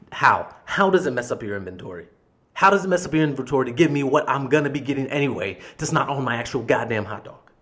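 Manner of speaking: rapid, indignant questioning; incredulous anger. Retold or imitated. Customer challenging manager's inventory excuse, incredulous and angry. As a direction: pile up the question in exasperation.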